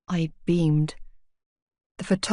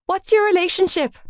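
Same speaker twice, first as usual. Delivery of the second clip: shouted question; 11 semitones higher than usual. A narrator's reading. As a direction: yell it over the crowd.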